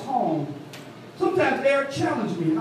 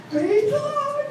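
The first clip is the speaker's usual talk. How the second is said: imitating mother-in-law; slower than usual.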